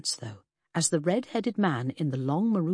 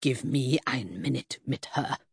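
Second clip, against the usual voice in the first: snarled; 4 semitones lower than usual. A narrator's reading. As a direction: lower snarled German threat. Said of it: "Hildebrand snarled".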